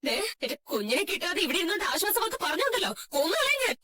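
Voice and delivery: distraught, rising threat; angry outburst through tears; tearful fury. Acted. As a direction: shut the other person up, half crying. Mother silences and threatens grandmother.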